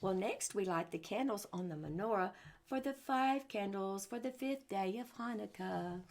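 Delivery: ordinary delivery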